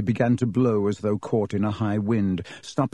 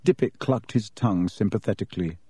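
ordinary delivery

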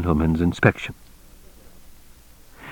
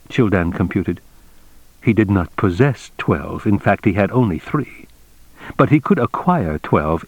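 ordinary delivery

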